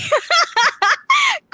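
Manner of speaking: bright burst of laughter; high laugh. Real, not acted. Laughs recalling bike rides.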